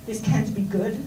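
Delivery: normal talking voice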